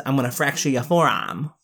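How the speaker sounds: imitated Boston accent, R dropped in forearm. Reviewer quotes Joe's threat, in imitated Boston accent. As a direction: quote it Boston-style, "fractcha ya forahm". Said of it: "It sounds like a Boston accent".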